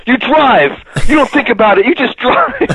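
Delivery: high, hammed-up street-tough boast; tough-guy boast. Fluffy bragging to Todd about fleeing police, hammed-up. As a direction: swagger about driving from the cops. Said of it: "he goes".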